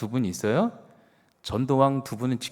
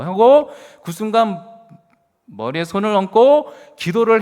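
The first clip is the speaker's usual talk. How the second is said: a preacher climbing into full force; fervent preaching; 9 semitones higher, 7 dB louder than usual. Acted. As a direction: pour it out like an impassioned revival preacher. Preacher recounting fervent prayer.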